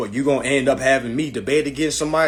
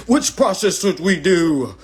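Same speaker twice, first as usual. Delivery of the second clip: mocking quote; 6 semitones higher, 7 dB louder, slower than usual. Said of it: "tagging people asking".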